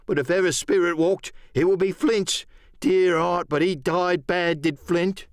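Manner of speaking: high, emphatic sailor voice; bandaged pirate, superstitious. Acted. Bandaged pirate confirming Flint is dead, high emphatic voice. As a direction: pitch it up with uneasy force.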